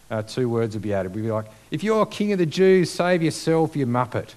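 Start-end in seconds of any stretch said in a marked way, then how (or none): 1.7-4.3 loud, high taunting jeer; mocking jeer. Preacher voicing a jeering crowd, loud mocking taunt. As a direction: taunt him like a modern heckler. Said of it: "that sort of mocking sort of feel to it".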